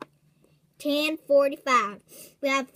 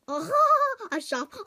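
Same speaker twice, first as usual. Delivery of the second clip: laughing; 9 semitones higher, 4 dB louder than usual. Acted. Laughing at the neighbour's shop, mocking. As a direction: laugh through the words, mocking. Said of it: "why are you laughing at me".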